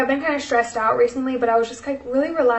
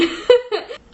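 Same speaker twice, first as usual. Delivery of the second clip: real laugh through tears; emotional laugh; teary laugh; 11 semitones higher than usual. Real, not acted. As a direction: laugh it off while choked up. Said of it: "I'm sorry for getting emotional".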